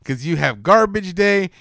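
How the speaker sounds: ordinary delivery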